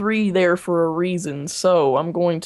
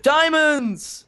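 excited; 9 semitones higher, 3 dB louder than usual. Real, not acted. Finding diamonds.

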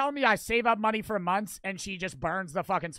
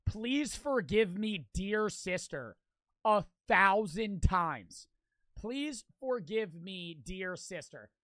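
slowly, dragging each word, trailing softer; slow tedious recital; 4 semitones lower, 7 dB quieter, slower than usual. Pretend. Reacting to the punishment, slow dragging mock recital. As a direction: drone it like writing lines for the thousandth time.

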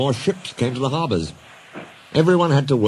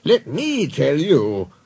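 slow, emphatic, higher than narration; authoritative boss; 5 semitones higher, much slower than usual. Acted. Boss silencing engines with news, authoritative. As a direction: announce it slowly with authority.

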